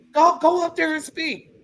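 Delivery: high insistent mock urging; pushy relative. A daughter imitating relatives pushing her to speak, high and insistent.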